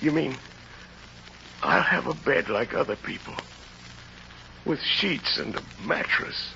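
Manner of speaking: soft, breathless disbelief; awed hush. Acted. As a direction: barely voice it, stunned. Convict stunned by offered bed.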